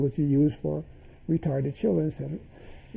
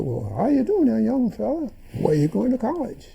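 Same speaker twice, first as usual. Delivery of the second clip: put-on raised higher, a friendly older man hailing a boy; kindly elder's greeting; 9 semitones higher than usual. Old doctor greets a young boy, genial and pitched up. Put his hand on my shoulder and said.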